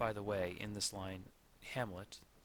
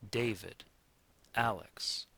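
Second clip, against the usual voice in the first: "David, Alex", each name strong then weak; stress on first syllable; 4 dB louder, slower than usual. Shown as a demonstration. Teacher giving names as examples, first syllable stressed in "David, Alex". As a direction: hit DA in "David" and AL in "Alex", dropping after. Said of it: "emphasis on the first syllable".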